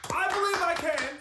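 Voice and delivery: excited. Acted. Guru's follower cheering on cue.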